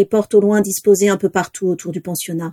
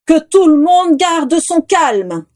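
higher, slow commanding call; Dumbledore's powerful voice; 8 semitones higher, 3 dB louder, slower than usual. A narrator's reading. Headmaster calls the panicked guests to order, slow powerful command. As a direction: project calm authority over the crowd. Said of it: "dit la voix puissante de Dumbledore".

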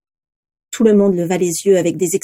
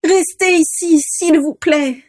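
high, very slow begging; pleading; 8 semitones higher, slower than usual. A narrator's reading. Vera begs her dragons again to stay, slow high plea. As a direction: stretch out the please, desperate. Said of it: "supplia Vera".

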